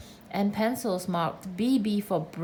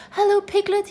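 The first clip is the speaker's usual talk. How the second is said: high, loud squeak; squeaky little Roo; 11 semitones higher, 8 dB louder than usual. A narrator's reading. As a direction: squeak it out like an excited little joey. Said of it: "he squeaked".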